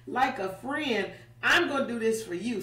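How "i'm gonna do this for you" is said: fast, light reassurance; quick promise. Retold or imitated. God tells Moses he'll do it, fast and casual. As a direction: toss it off quickly.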